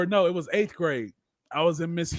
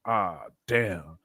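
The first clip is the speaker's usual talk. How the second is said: dread; 10 semitones lower, 7 dB quieter than usual. Retold or imitated. Caught, dreading the phone call.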